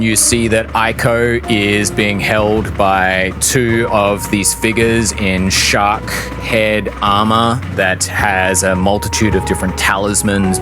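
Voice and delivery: usual speaking voice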